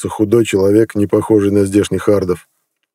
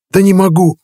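much higher, louder panicked protest; desperate cry; 10 semitones higher, 4 dB louder than usual. A narrator's reading. The scared healer protests to the raging chief, panicked. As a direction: blurt it out, cornered and flustered. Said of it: "покраснел лекарь".

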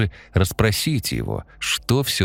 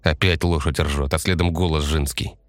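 ordinary delivery

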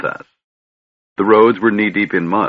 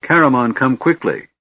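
raised, urgent call for help; 5 semitones higher, 3 dB louder than usual. A narrator's reading. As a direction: summon him quickly, a bit louder. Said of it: "A call split the afternoon's sweet silence".

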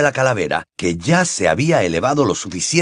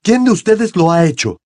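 raised, angry demand; brusque; 8 semitones higher, 4 dB louder than usual. A narrator's reading. Crouch glaring at the three children in rage, brusque. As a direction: demand it abruptly, pitched up. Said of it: "dijo bruscamente".

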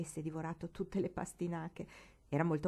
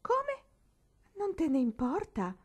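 very high shocked question; can't believe it; disbelief; 10 semitones higher, 5 dB louder, slower than usual. A narrator's reading. Incredula verso la mamma. (stupore incredulo e acuto)

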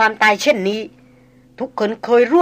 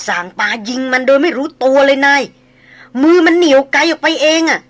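shaken, higher and louder telling; struggling to tell; 5 semitones higher, 6 dB louder than usual. Acted. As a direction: force the words out, still scared. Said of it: "เจ้าตองซูบอกอย่างยากเย็น". (เล่าอย่างยากเย็น ตื่นกลัว เสียงสูงดัง)

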